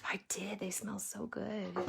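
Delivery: soft whispered delight. Real, not acted. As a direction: whisper it dreamily. Mom whispers about flowers.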